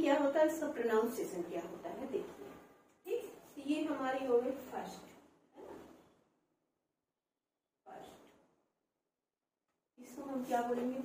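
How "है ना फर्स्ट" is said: ordinary talk, nothing marked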